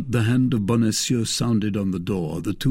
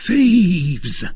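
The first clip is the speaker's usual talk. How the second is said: high, loud cry of alarm; 9 semitones higher, 7 dB louder than usual. A narrator's reading. Mercer discovering his stolen money bag, shouting. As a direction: yell for the thieves. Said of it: "was crying".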